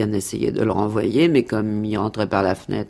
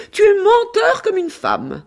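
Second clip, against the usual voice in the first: very high-pitched quoted shriek; high, hammed-up accusing yell; mocking cry; 6 dB louder than usual. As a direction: cry it out in a shrill imitation of her. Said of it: "qui riait sans tact et criait".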